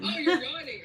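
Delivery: laughing. Real, not acted. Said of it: "I'm trying to yawn".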